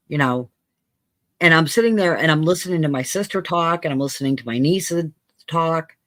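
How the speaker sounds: ordinary talk, nothing marked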